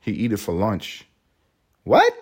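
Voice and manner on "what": squeaky high, loud disbelief; shocked what. Real, not acted. Hearing a rapper's crude lyric, shocked high what. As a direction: yelp of pure disbelief.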